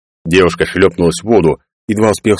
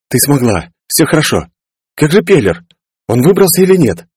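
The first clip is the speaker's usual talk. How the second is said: anxious concern; 8 semitones higher than usual. Acted. Girl and Clarence after escape.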